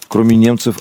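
ordinary talk, nothing marked

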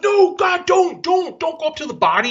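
high, urgent mock warning; retold alarmed plea. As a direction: act out a panicky don't-go warning.

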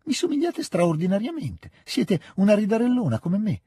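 put-on high-pitched, animated girlish scolding; excited daughter's protest. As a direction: scold your laughing mother in a high, lively voice. Natasha protests at mother's laughing. (protesta vivace, con voce acuta)

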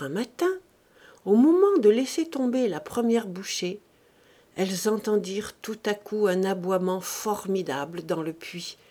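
usual speaking voice

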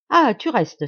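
high, loud burst of relief; clapping her hands as she cries out; joyful cry. Acted. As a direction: cry out as if she has won him over. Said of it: "s'écria-t-elle".

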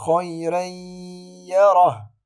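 خيرا يره with tanween merged into ya, loud held nasal; nasalized merge. Shown as a demonstration. Teacher reciting the correct merge, loud. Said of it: "ولا أقول".

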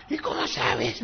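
put-on high, whispered old witch rasp. Pastor imitating the bitter woman's witchy voice. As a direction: croak it like a spiteful witch. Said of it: "Hasta la voz es así como la bruja escaldufa".

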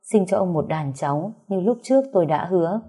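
ordinary delivery

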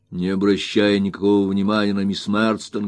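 usual speaking voice

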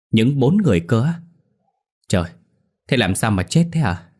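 shocked high loud question; astonished. A narrator's reading. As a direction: gasp out the news in disbelief. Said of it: "coi bộ kinh ngạc lắm". (kinh ngạc thốt lên)

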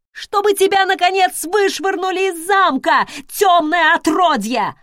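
high, loud furious scream; screaming in rage. Acted. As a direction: scream with hatred, losing control. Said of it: "заорала она".

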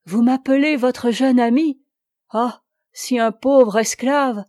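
a wounded lover's outcry; crying out. A narrator's reading. Lover protesting at being called friend, an indignant loud outcry. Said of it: "m'écriai-je".

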